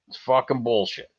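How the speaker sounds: usual speaking voice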